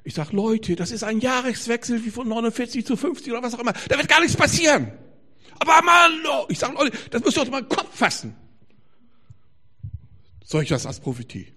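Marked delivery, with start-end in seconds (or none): 0.3-8.3 high, loud outburst at gullible people, building to a yell; retold rant, incredulous and heated; exasperated shouting. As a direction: plead at full volume for common sense. Exasperated retort to panic-mongers.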